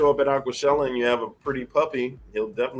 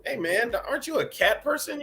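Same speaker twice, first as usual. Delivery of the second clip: much higher, lightly mocking tease; teasing question; 13 semitones higher than usual. Teasing a friend about being a cat person. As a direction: needle him about cats in a high jokey voice. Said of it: "when I was like".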